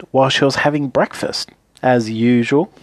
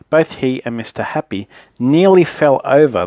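plain reading voice

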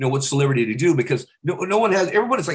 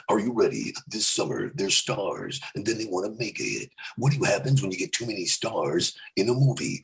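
lower, softer movie-trailer voice; Keanu Reeves impression; 5 semitones lower, 5 dB quieter than usual. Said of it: "Keanu Reeves".

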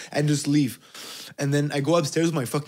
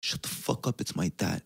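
whispered, much softer and lower; whispered hush; 7 semitones lower, 8 dB quieter than usual. Retold or imitated. Kid whispering to robbers that it's his dad on the phone. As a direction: hiss it under your breath. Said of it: "the slappings".

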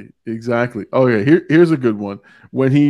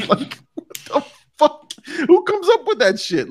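disbelief; 12 semitones higher than usual. Real, not acted. Laughing disbelief at quote.

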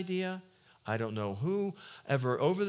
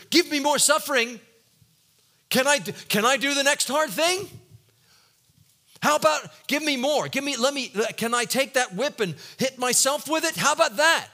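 louder, higher hammed-up zeal; mock eagerness; 9 semitones higher, 8 dB louder than usual. Preacher mocking eager self-punishment, loud and high. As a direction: parody of someone begging for pain. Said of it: "we're all running after".

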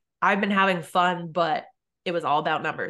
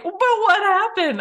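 "But what happened?" much higher and louder, pressing; insistent retold question; 13 semitones higher, 6 dB louder, slower than usual. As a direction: push the question hard, mock alarm. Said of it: "And they're like".

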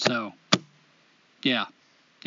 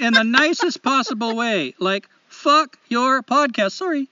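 higher, exaggerated Canadian lilt; Canadian accent; 5 semitones higher, 4 dB louder than usual. Host reading a listener's letter aloud, hammed-up Canadian accent. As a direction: put on a chipper, apologetic Canadian accent. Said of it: "in the best Canadian accent I know".

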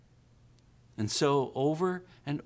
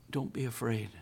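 gentle reassurance; 3 semitones lower, 7 dB quieter than usual. Pretend. Answer to worries, softer.

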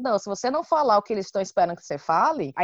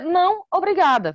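"Não, obrigada" raised and louder, as a model answer; polite refusal; 9 semitones higher, 5 dB louder than usual. Shown as a demonstration. Showing the answer the colleague expected, polite refusal. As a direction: demonstrate the full courteous "Não, obrigada", pitched high. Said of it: "Só que eu tinha que dizer".

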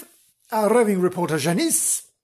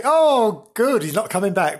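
gleeful relief; 6 semitones higher, 5 dB louder than usual. Retold or imitated. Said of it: "some people would say".

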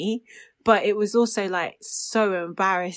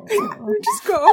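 laughing; 13 semitones higher, 5 dB louder than usual. Real, not acted. Laughing while recalling.

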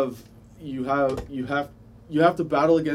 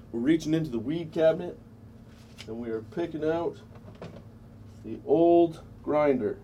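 stoner; 3 semitones higher, 4 dB quieter than usual. Real, not acted. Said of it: "I'm really high right now".

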